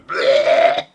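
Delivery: loud, sudden disgusted bleh; mock retch. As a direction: spit it out like someone gagging. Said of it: "somebody in the corner going".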